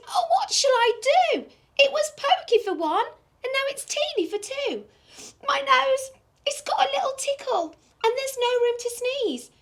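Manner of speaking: put-on high, fretful little old lady. Old lady crying to the wise old man for help. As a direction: wail it like a flustered old lady.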